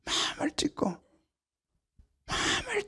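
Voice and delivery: hushed unvoiced repeat; whisper. Real, not acted. Preacher whispering the call to tear the heart. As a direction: let it fall to a whisper.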